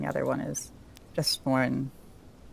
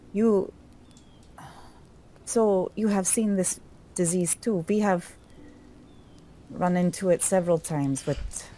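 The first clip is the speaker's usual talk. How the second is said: higher-pitched questioning character voice; higher voice, rising to a worried question; concerned priestess's protector; 4 semitones higher than usual. Acted. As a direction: ask anxiously in character, pitch lifted throughout. Plays Keltiel questioning the ranger.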